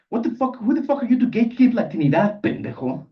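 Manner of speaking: loud, high-pitched indignation; angry challenge. Acted. Alumnus answering a challenge to his name, angry. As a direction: fire back, offended and loud.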